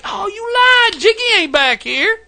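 high, surprised mock complaint; Mike's high-pitched mock whine; imitating Mike. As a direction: squeak it out like a guy who got tricked. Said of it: "he's like".

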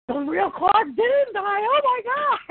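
high, slow, thrilled fan squeal; excited squeal. Retold or imitated. Excited fan reaction retold.

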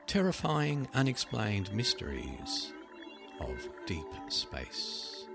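dramatic title read. Acted.

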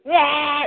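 high mock yell of frustration; frustrated cry. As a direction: cry out at the ruined plan. Frustration at closed Disneyland.

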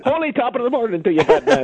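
a hint of an Irish accent as Robin; mock Irish-accented Robin. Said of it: "a Robin with an Irish accent".

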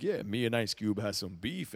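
low, quiet imitation of his talking voice; B-Real. Host imitating the rapper in interviews, low talking-voice impression. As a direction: drop well down, soft and low-key. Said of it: "he talked like this".

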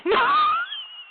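sudden, very high shrieking laugh. Real, not acted. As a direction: shriek with laughter at your own joke. Whoops at own punchline.

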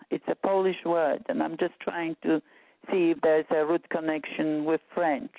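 ordinary talk

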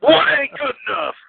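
very high-pitched holler; mock movie yelling. Quoting the door gag for friends, hammed-up yelling. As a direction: yell it out in a comic imitation. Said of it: "everybody's yelling".

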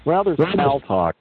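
ordinary talk

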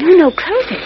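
nervous urgent order; urgent insistence. Acted. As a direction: make him shut it quickly. Lois insisting in dark museum.

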